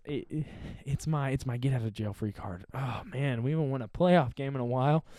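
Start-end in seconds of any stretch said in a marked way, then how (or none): none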